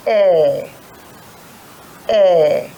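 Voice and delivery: a hint of a deaf old person asking "Eh, eh"; mock hard of hearing. Shown as a demonstration. Teacher voicing a girl pretending not to hear. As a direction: play an older person who can't hear. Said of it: "She pretends she can't hear".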